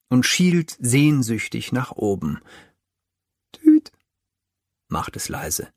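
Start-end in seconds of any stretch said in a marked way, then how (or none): 3.5-3.9 kleines, helles Hupen wie ein Spielzeugauto; tüte ganz hoch und kurz; hohes Tüten. A narrator's reading. Tiny horn sound. (hohes Hupgeräusch)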